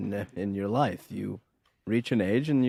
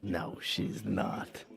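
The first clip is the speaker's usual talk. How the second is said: slow, barely voiced denial; stunned disbelief; 2 semitones lower, 8 dB quieter, much slower than usual. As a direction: let it out slowly in a hushed whisper. Stunned at news of Laura's wedding.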